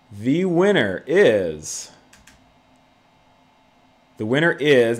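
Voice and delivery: slow, loud drumroll build-up; hyped giveaway announcement. Real, not acted. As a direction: stretch it out for suspense. Drawn-out giveaway winner reveal.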